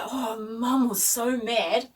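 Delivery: normal talking voice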